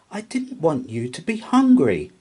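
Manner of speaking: high, loud, earnest mouse; explaining mouse. A narrator's reading. Mouse explains to Bear, high and earnest. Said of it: "explained Milly".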